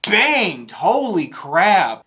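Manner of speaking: loud, high, emphatic praise; real awestruck outburst, drawn out at the end; blown away. Real, not acted. Reactor as the song just ended, blown away.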